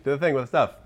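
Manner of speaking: usual speaking voice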